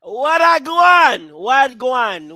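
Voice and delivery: slow, drawn-out Jamaican greeting, easing off from loud; mock Jamaican accent, stretched out and fading. Host mimicking the woman's accent, slow mock Jamaican. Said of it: "And got an accent".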